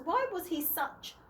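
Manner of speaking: usual speaking voice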